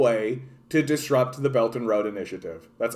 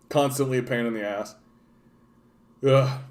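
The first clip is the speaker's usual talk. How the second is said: ordinary talk, nothing marked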